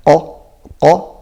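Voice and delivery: qa from the soft palate at the back. Shown as a demonstration. Teacher demonstrating where the qa touches. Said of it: "softer part of the palate at the back".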